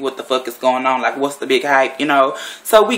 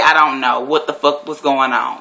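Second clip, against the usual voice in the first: ordinary delivery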